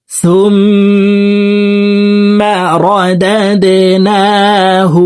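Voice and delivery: slow melodic tajweed chant; Quran recitation. Shown as a demonstration. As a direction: draw out each syllable in recitation. (ধীর সুরেলা তিলাওয়াত)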